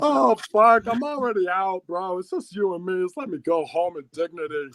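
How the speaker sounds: high whiny plea, easing off as it goes; mock pleading. Student begging principal to let him leave, high mock plea.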